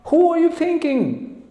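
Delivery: high, slow, scornful outbid; challenging businessman. Acted. Rich businessman outbids the pujari, haughty challenge. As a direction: throw down the challenge, pitched high and drawn out. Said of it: "this businessman ac-, accepted challenge".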